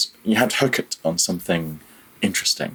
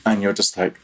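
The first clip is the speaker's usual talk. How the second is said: usual speaking voice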